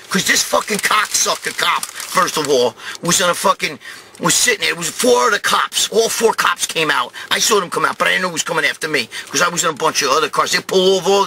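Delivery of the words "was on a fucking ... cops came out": ordinary talk, nothing marked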